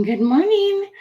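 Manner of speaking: lilting high-pitched greeting; sing-song good morning. Real, not acted. Sings morning up high to the viewers.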